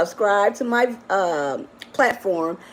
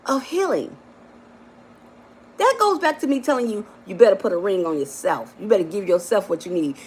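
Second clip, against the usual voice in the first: ordinary delivery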